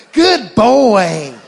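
loud, high praise for a dog; dad cheering his dog mid-business; cheerleading the dog. Acted. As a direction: cheer like a proud coach.

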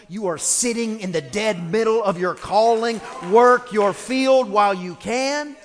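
rising to a loud, high preaching cry, slowing at the end; preaching climax. Real, not acted. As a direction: lift the congregation with full-force preaching.

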